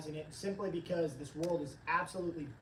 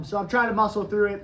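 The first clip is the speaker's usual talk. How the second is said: usual speaking voice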